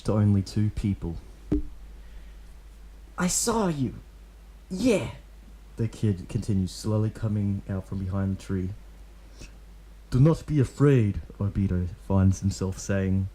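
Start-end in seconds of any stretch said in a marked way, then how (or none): 3.2-5.1 put-on high, eager kid voice; young boy voice. A narrator's reading. Kid calling out to Obito, high boyish voice. 10.1-11.2 a touch louder, lightly voiced masked ninja; calm ninja voice. A narrator's reading. Obito telling the kid not to fear, firm.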